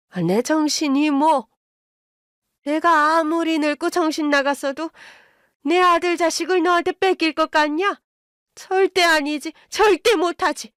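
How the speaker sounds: put-on high, insistent old mother-in-law; indignant fury. A narrator's reading. Mother-in-law will not lose grandchild, high indignant fury. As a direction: climb steadily into high-pitched refusal.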